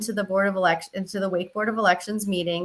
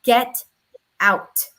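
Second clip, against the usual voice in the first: a curt, firm get out; mildly mock a man ordering her out; stern order; 2 semitones higher, 4 dB louder than usual. Retelling how Gary Sims ordered her out, firm and curt. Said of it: "he gets right in my face and he says".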